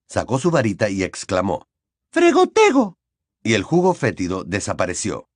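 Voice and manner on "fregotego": very high, loud spell call; spell cast. A narrator's reading. Ginny casts a spell.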